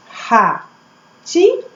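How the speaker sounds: "ratinho" split as ra... ti... nho; teacher counting out "ratinho" by syllables. Shown as a demonstration. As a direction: sound out "ratinho" in three separate beats. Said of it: "eu abro três vezes".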